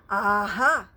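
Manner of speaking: loud triumphant aha; knowing cry. Acted. Wonka teases Grandpa Joe, loud knowing aha. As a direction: relish the secret. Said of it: "cried Mister Wonka".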